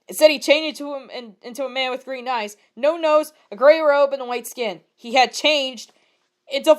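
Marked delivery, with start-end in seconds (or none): none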